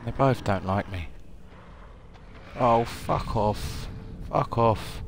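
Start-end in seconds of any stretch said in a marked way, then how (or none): 2.6-3.9 slow, stretched exasperated curse; drawn-out groan. Real, not acted. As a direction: drag the words out in despair. Two zombies chase him.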